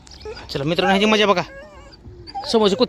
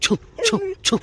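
shooing dogs; slower than usual. Real, not acted. Shooing stray dogs, high.

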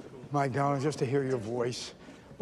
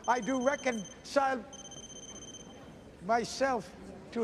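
raised, measured stage recitation; Shakespeare recital; 11 semitones higher than usual. Acted. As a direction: declaim it to the whole court.

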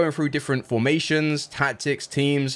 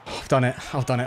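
relieved cry; faster than usual. Real, not acted. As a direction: let it all out at once. Winning the promotion match.

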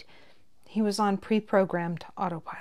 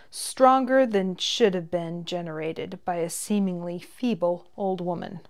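usual speaking voice

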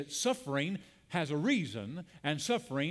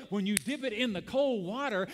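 ordinary delivery

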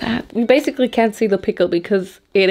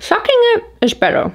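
high silly voice; 14 semitones higher, faster than usual. Acted.

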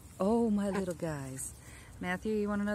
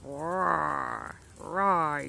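mock dinosaur roar, a bit lower; 3 semitones lower, 3 dB louder than usual. Voicing the attack duck as a mock dinosaur roar. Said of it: "put some dinosaur sound effects".

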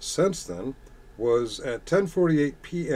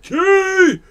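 loud, very high drawn-out shriek; hammed-up heckler's yell, pitch shooting up; mock yelling; 12 dB louder than usual. Streamer imitating everyone in chat yelling out, mock shriek. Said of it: "Everyone yelling out".

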